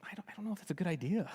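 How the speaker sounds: fast, hushed hesitant whisper; nervous whisper. Retold or imitated. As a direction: whisper your doubt, afraid to say it. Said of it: "Bilam is like".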